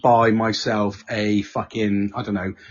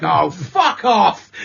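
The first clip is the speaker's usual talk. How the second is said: laughing fed-up; 5 semitones higher, 3 dB louder, much slower than usual. Retold or imitated. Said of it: "And it's like".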